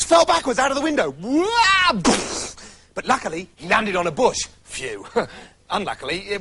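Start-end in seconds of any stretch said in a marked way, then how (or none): 1.2-2.0 high wail as he falls; the prince wails falling from the window. Acted. 4.6-5.1 much softer, lower sigh of relief; a quiet phew as he lands; soft relieved phew. Acted. Prince lands on bush.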